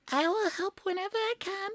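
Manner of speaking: very high, strained character voice; seldom-heard shy helper. Acted. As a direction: offer help in a tiny high voice. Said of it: "We never heard his voice".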